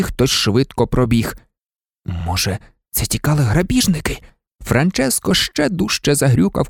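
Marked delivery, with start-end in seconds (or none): none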